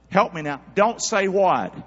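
ordinary talk

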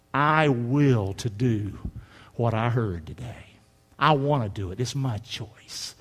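low, slow and soft, a deliberate vow; quiet resolve. Acted. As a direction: say it slowly, low and hushed, as a personal decision. Voices the listener's deliberate choice.

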